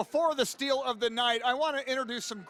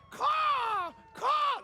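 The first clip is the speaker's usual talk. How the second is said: crow caw; 12 semitones higher than usual. Pretend. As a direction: squawk like a wild bird. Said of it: "That's my wild bird in person".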